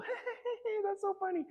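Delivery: high giggling mock-female voice; tittering, squealed pretend amusement; effeminate impression. Acting out the date before she touches his arm, effeminate. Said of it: "so she'll go".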